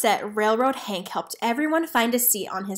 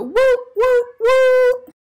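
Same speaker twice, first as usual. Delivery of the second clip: very high, loud whistle hoots; train whistle; 10 dB louder, slower than usual. Acted. The train blowing its whistle as it leaves, loud. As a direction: hoot like a steam train.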